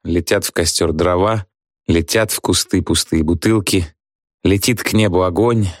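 ordinary delivery, nothing marked

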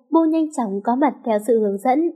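ordinary delivery, nothing marked

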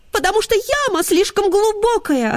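put-on high, light girlish voice; young girls' voice. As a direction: speak like a young girl. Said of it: "ответили девочки".

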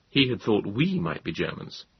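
usual speaking voice

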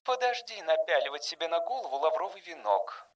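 hushed word of caution. A narrator's reading. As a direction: warn quietly, voice lifted and light. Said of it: "предостерегла его Ронда".

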